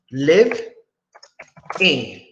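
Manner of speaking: "live in" with a long pause between the two parts; syllable by syllable. Shown as a demonstration. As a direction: sound out "live", wait, then "in". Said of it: "Two syllables".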